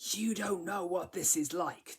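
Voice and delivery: mild frustrated outburst; bitter accusation. Shown as a demonstration. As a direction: throw it back at the reader. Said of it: "quite angry and quite bitter and quite accusatory".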